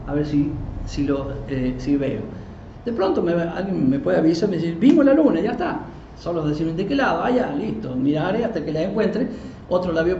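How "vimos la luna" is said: high, louder quoted announcement; imitated excited alert. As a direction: lightly act someone reporting a sighting. Voicing someone alerting him to sighting.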